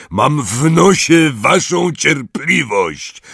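very loud, slow, high roar. Acted. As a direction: bellow it at them. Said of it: "ryknął".

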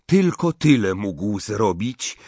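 ordinary delivery, nothing marked

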